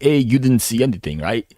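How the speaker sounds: fast, hammed-up Italian mobster accent; a quick, badly faked Italian Mafia accent. Mocking the old man as a Mafia type, bad Italian accent. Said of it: "that was a terrible attempt at an Italian accent".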